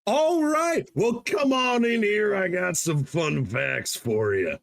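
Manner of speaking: high, drawn-out carnival-style welcome; hammed-up showman. Host invites co-host to hear fun facts, hammed-up showman.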